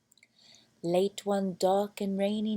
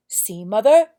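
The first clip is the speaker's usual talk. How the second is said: loud, triumphant cry; the prince proves his mother wrong with joy; jubilant, loud exclamation; happy prince; 13 dB louder than usual. A narrator's reading. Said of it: "said the happy prince".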